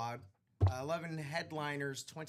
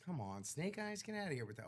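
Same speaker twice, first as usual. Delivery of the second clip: ordinary delivery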